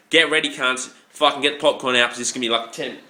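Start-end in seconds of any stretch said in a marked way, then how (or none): none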